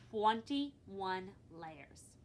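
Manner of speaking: very slow, stretched twenty-one layers; storyteller slowly revealing the number; dramatic reveal. A narrator's reading. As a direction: draw out twenty-one for effect. Building suspense on number.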